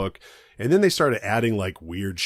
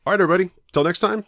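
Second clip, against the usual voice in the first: higher, louder announcer sign-off; outro sign-off; 10 semitones higher, 5 dB louder, much faster than usual. Real, not acted. Host signs off to the listeners, bright announcer voice. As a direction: send the listeners off like a show host.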